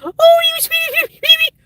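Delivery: put-on gushing pet-owner baby voice; baby talk. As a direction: coo it like a doting pet owner. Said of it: "and says".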